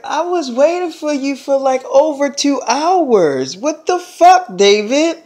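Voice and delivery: high-pitched imitation of an upset girlfriend; girlfriend with attitude. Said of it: "she had a little attitude".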